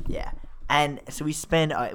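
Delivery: ordinary talk, nothing marked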